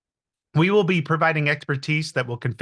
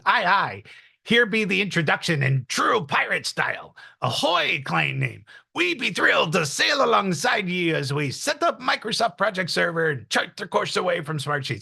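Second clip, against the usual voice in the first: hammed-up pirate accent, pitched higher; bad pirate accent; 3 semitones higher than usual. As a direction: ham it up like a cartoon pirate accent. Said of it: "That's a horrible pirate accent".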